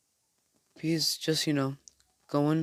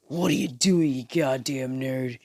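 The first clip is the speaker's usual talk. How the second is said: angry bully. Acted. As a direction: confront the nerd with acted annoyance. Bully confronts Izuku in told story.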